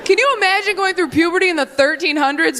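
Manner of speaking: ordinary delivery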